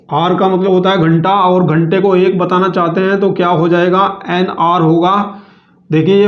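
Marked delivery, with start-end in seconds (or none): none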